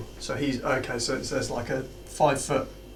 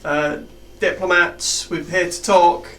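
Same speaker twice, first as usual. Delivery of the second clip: loud, slow call across the room; calling out loud to the were-rats; yelling out; 6 semitones higher, 10 dB louder, slower than usual. Acted. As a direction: shout it so they hear you're peaceful. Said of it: "I'll yell out".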